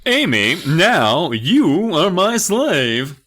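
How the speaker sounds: high, loud, slow dragged-out dummy voice; Slappy's new voice. Acted. To Amy, the dummy announces she is his slave in a slow, loud high rasp. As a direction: stretch every word out like a creepy dummy. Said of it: "That's his, that's his new voice".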